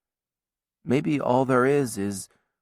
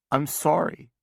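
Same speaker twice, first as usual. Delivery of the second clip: higher, tearful I'm sorry, breath catching; sobbing apology; 6 semitones higher than usual. A narrator's reading. Sister sobs her confession to her brother, tearful. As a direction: choke out the apology through tears. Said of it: "Now I'm sobbing".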